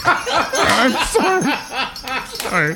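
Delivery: a high, slow apology broken by laughter; laughing apology. Real, not acted. Comic breaking down mid-poem in front of co-hosts, laughing. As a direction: apologize while cracking up.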